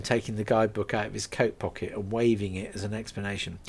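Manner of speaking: ordinary delivery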